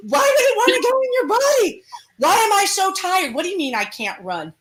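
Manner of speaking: high, whining complaint; whining demon. Acted. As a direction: whine like a worn-out demon.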